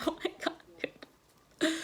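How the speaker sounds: whispered mock disbelief; hushed, retold astonishment; imitated shock. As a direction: gasp it under your breath. Imitating girl's shocked reaction.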